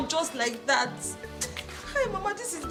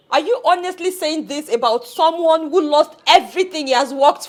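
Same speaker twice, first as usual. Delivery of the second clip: furious outburst; 8 dB louder than usual. Acted. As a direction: yell at Papa for his cruelty. Son confronts father about fiancé.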